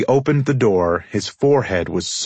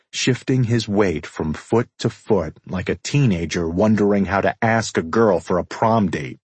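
plain reading voice

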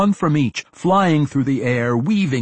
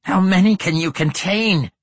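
ancient lich voice; 8 semitones higher, 5 dB louder than usual. A narrator's reading. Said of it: "As the undead creature finished speaking".

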